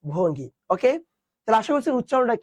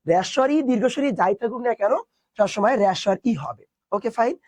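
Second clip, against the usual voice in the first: ordinary delivery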